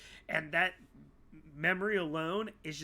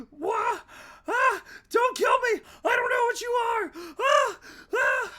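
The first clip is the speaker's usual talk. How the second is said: shrieked very high and loud; Sarah shrieking in terror over the radio; screaming in terror; 10 dB louder than usual. Acted. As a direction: scream for your life down the radio. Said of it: "Sarah screaming on the radio".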